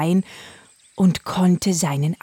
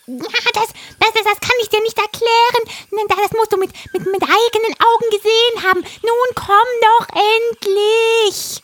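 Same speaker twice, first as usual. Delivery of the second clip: high, loud parrot voice; a frantic parrot urging; excited parrot; 5 dB louder than usual. Acted. Parrot voice held. (hohe, aufgeregte Papageienstimme)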